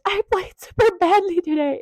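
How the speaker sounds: high-pitched voice of the actress; lightly caricatured leading lady; mock girlish Sybil. Sybil greeting Dorian backstage, high mock-girlish voice.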